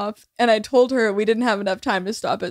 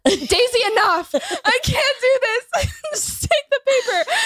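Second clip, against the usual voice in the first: exasperated plea; 10 semitones higher than usual. Retold or imitated. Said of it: "Lily goes".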